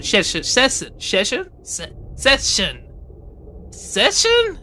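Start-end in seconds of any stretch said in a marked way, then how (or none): 3.7-4.6 mock questioning. Shown as a demonstration. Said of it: "it sounds a bit weird".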